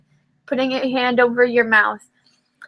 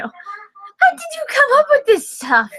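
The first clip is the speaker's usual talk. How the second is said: high, slow, incredulous; amazed; 10 semitones higher than usual. Acted. Friend marvelling at Gilbert's prank, amazed and slow.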